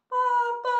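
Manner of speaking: put-on high, loud child's wail; hammed-up bawling kid; loud crying. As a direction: wail for your father, acting a sobbing child. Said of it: "sambil menangis dengan keras".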